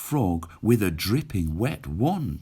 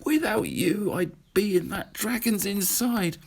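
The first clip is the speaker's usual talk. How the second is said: the rescued witch tells her friends they saved her; grateful witch; 11 semitones higher than usual. Acted. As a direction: thank them with relief. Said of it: "the grateful witch cried".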